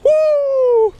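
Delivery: high whoop of triumph; high excited woo. Real, not acted. Angler cheering the huge ling, high whoop. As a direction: whoop as if winning.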